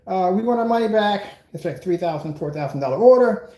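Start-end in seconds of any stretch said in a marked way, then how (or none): 0.1-1.2 high, loud whiny customer voice; imitating a complaining client. Businessman retelling a client's complaint, high, loud whiny voice. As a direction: mimic the client demanding a refund, high and loud. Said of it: "they go".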